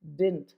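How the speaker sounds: DIDN'T as a single low syllable, dint; one-syllable "didn't"; contracted didn't. Shown as a demonstration. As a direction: say "didn't" as dint, middle d dropped. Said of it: "it is always".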